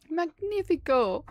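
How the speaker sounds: high, slow, grand Italian-style flourish; theatrical flourish. Pretend. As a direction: savour it like a showman. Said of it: "I don't know why I said it like that".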